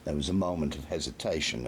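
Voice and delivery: ordinary delivery, nothing marked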